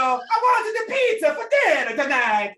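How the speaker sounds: high, loud cartoon Italian accent; Mario accent. Gamer barging into a Zoom class, loud Mario accent. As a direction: burst in as an excited Italian Mario. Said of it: "this terrible Italian accent".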